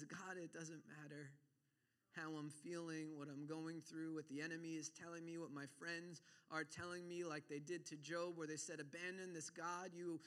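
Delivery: low, soft, steady prayer; quiet resolve. Acted. Preacher voices a faithful believer in trial, quiet resolve. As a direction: confide in God quietly and evenly.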